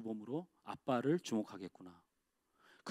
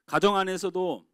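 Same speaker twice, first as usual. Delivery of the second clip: usual speaking voice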